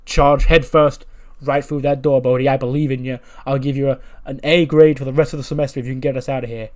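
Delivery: loud rallying pep talk; rousing order. Acted. Teacher sending his student head first at the door, rousing order. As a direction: urge him on with force.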